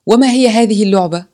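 storyteller voicing a shouting kid; child's shout. A narrator's reading. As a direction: call out curiously like a child. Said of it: "صاح أحد الأطفال".

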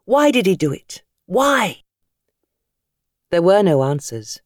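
usual speaking voice

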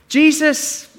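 a lightly voiced God hailing his Son; God calling his Son. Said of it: "this is God speaking, by the way".

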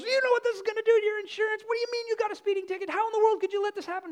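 high, fast, exasperated dad; high, fast, upset father; dad flipping out. Retold or imitated. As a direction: rant like a furious father. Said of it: "dad flips out and goes".

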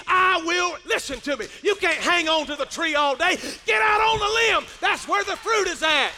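loud, high, fired-up sermon delivery; full-force preaching. Real, not acted. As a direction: build to a shouted exhortation to the congregation.

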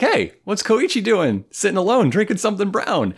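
high-pitched imitation of curious pals; mock nosy curiosity. Two friends spot Koichi sitting alone, mock curious. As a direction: pitch it up, playfully puzzled.

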